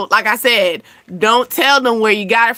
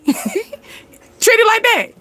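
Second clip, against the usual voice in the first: very slow and higher, laughed through; amused at her own gas money idea; laughing; 10 semitones higher, much slower than usual. Real, not acted. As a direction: let the laugh stretch the words out. Laughing while giving advice.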